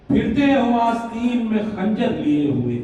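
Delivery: projected, rhythmic mushaira delivery; emphatic Urdu verse with deliberate pauses; ghazal declamation. Acted. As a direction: throw each couplet out to the audience. Declaims ghazal couplets to audience.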